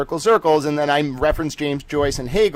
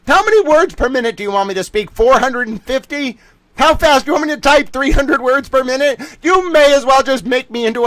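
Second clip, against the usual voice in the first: excited rant; 8 semitones higher, 4 dB louder than usual. Real, not acted. Mock pitch of fast talk.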